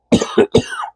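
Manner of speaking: a hacking cough, congested chest; real chesty cough. Real, not acted. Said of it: "I apologize".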